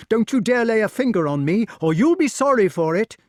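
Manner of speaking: high, loud, defiant threat; desperate shouted warning; crying out. A narrator's reading. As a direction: yell it as a struggling captive boy. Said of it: "cried the wart".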